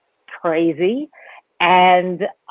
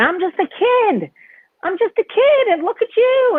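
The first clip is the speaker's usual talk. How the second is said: put-on much higher, childlike squeak; inner kid voice. Comic voicing her inner child, in a much higher kid voice. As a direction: sound like a small amazed kid.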